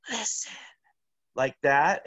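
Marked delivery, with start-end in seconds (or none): 0.1-0.5 a soft high command, hushed; ghostly whisper. Retold or imitated. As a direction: hush it like a phantom. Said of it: "the voice just goes".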